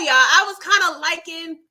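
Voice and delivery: ordinary talk, nothing marked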